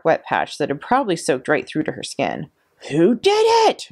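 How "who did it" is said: high, loud furious roar. A narrator's reading. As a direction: bellow it at the whole class. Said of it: "she roared".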